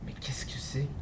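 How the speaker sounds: hushed, breathy question; whispered question. Acted. The snake whispers to the mouse, curious and uneasy. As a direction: whisper it as if afraid to ask.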